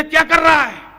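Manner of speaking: sudden loud, high yell; indignant shout. Retold or imitated. Frog yelling at the stone thrower, indignant shout. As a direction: burst out like an affronted frog.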